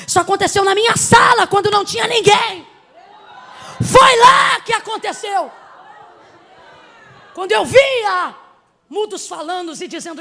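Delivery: loud, fervent pulpit preaching; preaching at full force. Acted. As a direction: preach it hard to the congregation.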